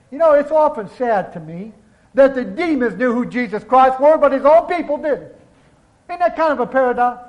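ordinary delivery

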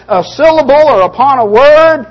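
"word" punched high and loud, held up; heavy preacher's emphasis. Shown as a demonstration. Preacher showing how audiences get worked up, heavy rising emphasis. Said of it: "put the right emphasis and enough emphasis".

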